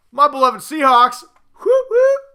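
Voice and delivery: loud, high whooping cheer; excited cheer. Real, not acted. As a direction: whoop it up for the team. Cheering for his team.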